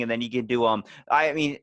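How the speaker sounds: ordinary talk, nothing marked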